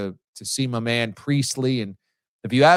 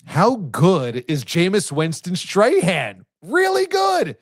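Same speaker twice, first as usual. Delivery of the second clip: impressed; 7 semitones higher, 3 dB louder, much slower than usual. Real, not acted. Host gushing over clip.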